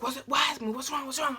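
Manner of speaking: high, rushed imitation of alarm; retold panicky questions. Brother asks what is wrong, retold in high-pitched panic. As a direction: act out a brother freaking out. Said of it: "Everybody was just freaking out".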